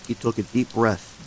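ordinary delivery

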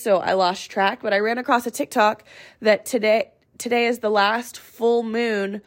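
ordinary talk

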